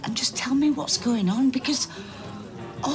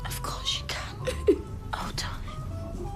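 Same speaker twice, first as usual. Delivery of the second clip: soft tearful whisper; tender whisper; 7 dB quieter, slower than usual. Acted. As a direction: comfort her in a hushed voice. Comforting a freezing visitor.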